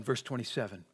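normal talking voice

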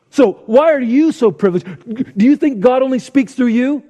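indignant challenge. Retold or imitated. Preacher voicing Moses' accusers, indignant and loud.

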